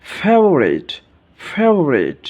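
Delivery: "ফেভারিট" with an airy English f; demonstrated F sound. Shown as a demonstration. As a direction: teeth on lip to start "ফেভারিট". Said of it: "উপরের দাঁত নিচের ঠোঁটের সাথে". ("ফেভারিট" এর ফ দাঁত-ঠোঁটে বাতাস দিয়ে)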